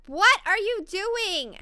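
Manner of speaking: loud, high, drawn-out "what are you doing"; exasperated scolding. Real, not acted. Gamer to her sleepy Sims character, loud exasperated scolding. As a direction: raise your voice in drawn-out disbelief.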